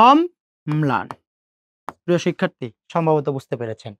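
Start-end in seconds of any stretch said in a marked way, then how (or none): 0.1-1.1 অম্লান said very slowly, অম then লান; slow syllable demo. Shown as a demonstration. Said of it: "তার সাথে আমরা সেই লটা যুক্ত করে দেব". (অম্লান ধীরে ধীরে ভেঙে ভেঙে উচ্চারণ)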